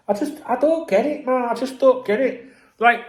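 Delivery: high-pitched baffled complaint; exasperated. Real, not acted. Fan baffled by all this, exasperated. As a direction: voice shoots up in frustration. Real rant, baffled and fed up.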